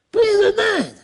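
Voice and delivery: high, ringing repeat; mock exclaimed pun. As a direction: sing out the pun again.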